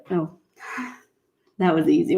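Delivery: normal talking voice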